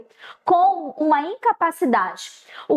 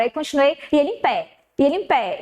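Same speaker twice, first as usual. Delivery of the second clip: usual speaking voice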